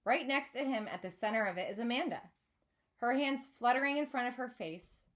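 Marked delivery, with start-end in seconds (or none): none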